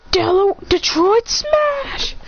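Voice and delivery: high, slow attack shout; high battle cry. Acted. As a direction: belt the move name. Hero calls attack name.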